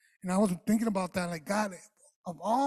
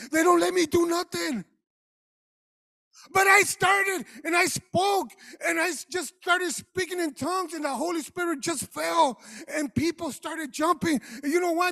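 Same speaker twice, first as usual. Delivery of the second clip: higher, louder preaching; preaching at full force; 6 semitones higher, 4 dB louder than usual. Acted. Pastor tells of a dead church revived, loud preaching. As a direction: build it up with fire, higher and louder.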